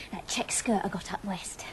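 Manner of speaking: soft confiding whisper; hushed, breathy confidence to a friend; whispered aside. Acted. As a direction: whisper it like a secret. Confiding about her new skirt.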